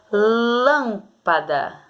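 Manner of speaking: "Lâmpada" drawn out very slowly, syllable by syllable; slow sounding out. Shown as a demonstration. Teacher sounding out "Lâmpada" for pupils, very slow. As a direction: stretch "Lâmpada" so the stress shows. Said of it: "falar com calma pra você descobrir".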